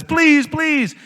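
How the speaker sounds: high, mock desperate begging; pleading mother. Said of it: "drops to her knees and says".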